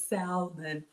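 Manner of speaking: "salmon" slow, L fully sounded, SAL-mun. Retold or imitated. Host reading Wilbert's vote, salmon with the L. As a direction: draw out the L in "salmon". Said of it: "If you pronounce salmon".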